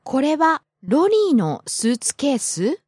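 スーツケース falls, then sweeps back up at the very end; rising question intonation. Shown as a demonstration. Student repeats the question on request, rising question intonation. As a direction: make スーツケース a question with a final upward lift. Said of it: "she just took the intonation up at the end".

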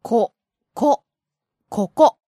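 ko, ko, koko built up one syllable at a time; slow syllable by syllable. Shown as a demonstration. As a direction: break koko into syllables, then say it whole. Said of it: "Please say them, [name] sensei".

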